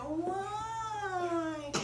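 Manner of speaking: high drawn-out whiny why. Acted. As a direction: whine like a sulky child. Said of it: "she would do the whiny voice".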